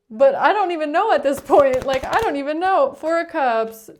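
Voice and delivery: loud frustrated complaint; exasperated outburst. Real, not acted. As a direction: throw up your hands, exasperated. Frustrated outburst at tarot cards.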